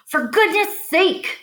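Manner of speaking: drenched farmer bellows at Lurvy; bellowed. Acted. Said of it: "bellowed Mister Zuckerman".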